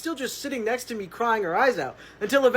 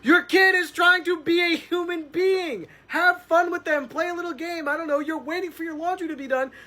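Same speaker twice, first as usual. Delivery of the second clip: mock exasperated plea, pitched up; pleading narrator; 8 semitones higher than usual. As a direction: beg the mom to play with her kid. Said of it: "points at the iPad and is like".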